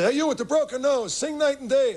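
lightly voiced mobster summoning the singer; wiseguy barking a song request, half in jest; mobster heckler. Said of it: "those guys say".

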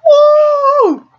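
loud excited whoop; high whoop. Real, not acted. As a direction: cheer it out high.

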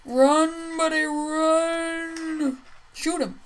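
calling out. Pretend. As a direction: stretch the last word out long. Calling out to teammate in game.

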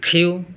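খিউ said with a breathy kh; teacher showing খিউ; aspirated Q. Shown as a demonstration. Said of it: "কিউ বলে না".

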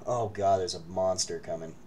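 ordinary delivery

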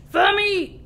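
high, loud mealtime roar; boisterous pirate chorus; crew roar. A narrator's reading. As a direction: roar it back to the captain. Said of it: "we all roared".

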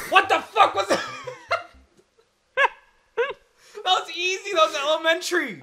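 loud, high laughing disbelief; laughing shock. Real, not acted. Player surprised at clearing the level, laughing loud and high. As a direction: laugh it out, stunned then smug.

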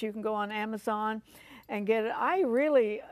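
ordinary talk

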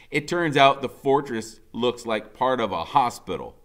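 usual speaking voice

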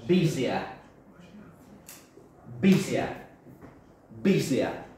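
busier, said slowly and clearly for the class; model pronunciation. Shown as a demonstration. Teacher modelling busier for Spanish learners, clear and careful. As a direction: drill the word busier clearly. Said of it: "How many syllables?".

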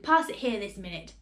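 plain reading voice

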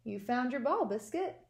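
usual speaking voice

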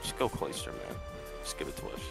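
ordinary talk, nothing marked

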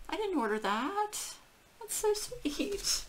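high-pitched, welling up; touched by a surprise gift, voice rising high; emotional. Real, not acted. Dog owner receiving an unexpected gift, emotional. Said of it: "I still get a little emotional".

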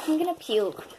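high, queasy disgust; disgusted, about to gag. Real, not acted. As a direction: say it like you might gag. Reacting to nasty candy.

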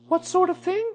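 put-on very high little-boy question; child's voice. A narrator's reading. Boy questioning the bear overhead, high child's voice. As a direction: ask with a child's curiosity.

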